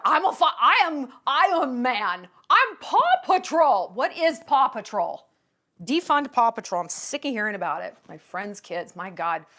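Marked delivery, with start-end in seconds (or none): 0.0-3.9 put-on convinced four-year-old. Four-year-old who believes he's a hero, loud conviction. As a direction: proclaim it with a preschooler's total conviction. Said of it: "he believes it".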